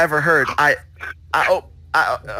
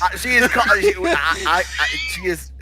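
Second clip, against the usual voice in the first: flustered; 7 semitones higher than usual. Acted. Flustered excuse.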